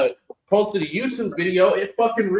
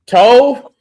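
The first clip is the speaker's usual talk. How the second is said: louder, higher call across the room; 7 semitones higher, 10 dB louder than usual. Real, not acted. Calling co-host over to be scolded, loud call. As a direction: shout a name to get attention.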